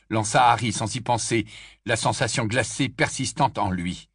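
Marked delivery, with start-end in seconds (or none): none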